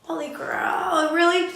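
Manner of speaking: slow stunned "really?" climbing high; disbelief. Retold or imitated. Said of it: "was like".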